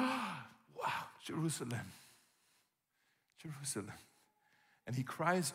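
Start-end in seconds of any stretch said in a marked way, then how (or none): 0.0-3.9 mournful. Retold or imitated. Jesus weeping over city.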